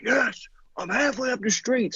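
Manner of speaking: put-on high, slow exasperated kid's reply; yelling back. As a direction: holler back up the street at mom. Boy yelling back to his mother.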